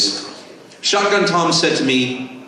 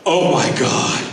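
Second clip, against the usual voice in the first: starstruck; slower than usual. Retold or imitated. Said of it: "And I went".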